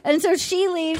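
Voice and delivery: ordinary talk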